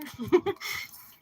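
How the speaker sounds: high burst of scornful laughter; mocking laugh. Real, not acted. Reader laughing at the schemers' daydream. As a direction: laugh out at them.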